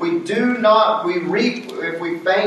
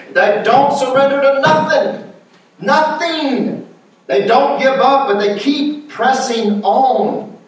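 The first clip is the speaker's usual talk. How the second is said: higher-pitched, ringing preacher's cry; fervent preaching; 7 semitones higher, 3 dB louder than usual. Real, not acted. Preacher praising people who keep pressing on, fervent preaching. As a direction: drive it home with stretched emphasis.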